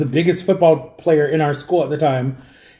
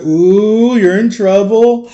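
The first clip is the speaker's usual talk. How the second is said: loud, higher, drawn-out schoolkid "ooh"; mock-scandalized classmates, stretched out; taunting class; 3 semitones higher, 4 dB louder, much slower than usual. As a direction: tease like a whole class singling someone out. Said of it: "The whole class is like".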